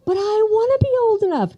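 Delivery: put-on high childish whine of a four-year-old; pleading little girl. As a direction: beg like the little sister left out. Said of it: "And I would say".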